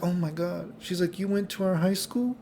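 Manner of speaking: high, soft mock astonishment; imitated amazed girl. Girl amazed at seeing him.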